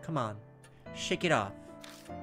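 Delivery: ordinary talk, nothing marked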